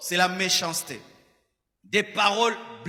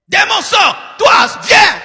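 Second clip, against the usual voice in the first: much higher, roaring exorcist command; deliverance shout; 11 semitones higher, 14 dB louder, much slower than usual. Retold or imitated. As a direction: bark at the demon with authority. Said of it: "Il n'y a pas de douceur".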